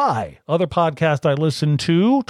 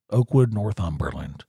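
ordinary talk, nothing marked

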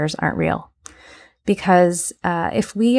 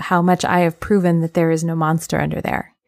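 ordinary talk, nothing marked

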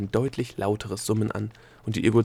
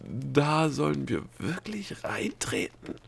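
slow, higher, disbelieving whisper; 5 semitones higher, slower than usual. A narrator's reading. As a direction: whisper it slowly in reluctant doubt. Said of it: "flüsterte Delia".

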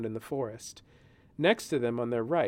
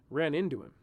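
usual speaking voice

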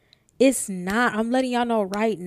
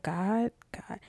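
anxious; 10 dB quieter than usual. Retold or imitated. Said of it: "I'm anxious".

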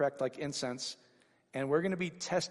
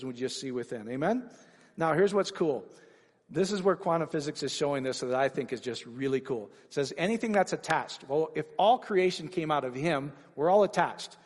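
ordinary talk

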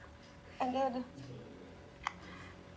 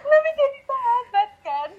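laughing; 11 semitones higher than usual. Real, not acted. Still laughing after the story.

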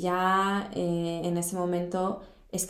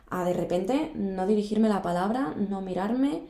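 normal talking voice

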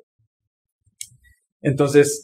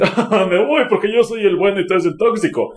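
mock moral superiority; 4 semitones higher than usual. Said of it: "montarme en mi caballo de superioridad moral".

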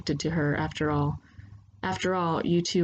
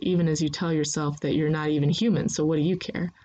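plain reading voice

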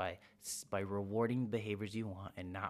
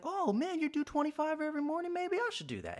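much higher, excited, wide-eyed voice; impressed coworker; 3 dB louder than usual. Pretend. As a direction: gush like you just learned a great idea. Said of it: "somebody else will be like".

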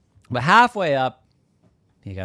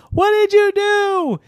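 loud, very high outburst; horrified cry; 7 dB louder than usual. Acted. Llama finding its head moved, horrified cry. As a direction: shriek it in alarm.

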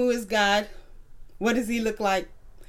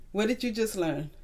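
ordinary talk, nothing marked

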